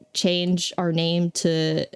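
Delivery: ordinary talk, nothing marked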